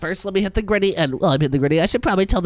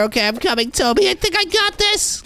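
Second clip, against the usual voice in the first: high excited voice; 13 semitones higher than usual. Acted. Psyching up before the lift.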